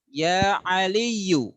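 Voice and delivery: ya Aliyu, slow, ending in u with no n; correct reading. Shown as a demonstration. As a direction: drop the n: ya Aliyu. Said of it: "Jangan dibaca ya Aliyun".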